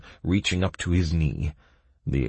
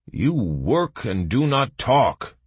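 slow, raised-pitch growl; growled order; 8 semitones higher, 4 dB louder, slower than usual. A narrator's reading. Guard silencing the squabbling prisoners, growling. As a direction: growl it slowly, spacing the words. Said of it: "growled the guard".

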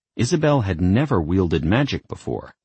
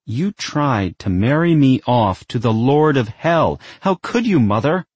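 accusing; 7 semitones higher, 4 dB louder than usual. Acted. Isabel accuses her mother.

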